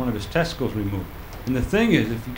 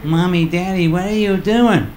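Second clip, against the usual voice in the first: put-on higher, slowed little kid asking; child's voice; 4 semitones higher, 4 dB louder than usual. Kid at the bedroom door, slow little child voice.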